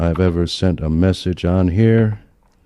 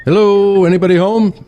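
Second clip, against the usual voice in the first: loud, much higher call through the house; 13 semitones higher, 7 dB louder, faster than usual. Acted. Grandpa arriving, calling loudly through the house for family. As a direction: call out to find anyone home.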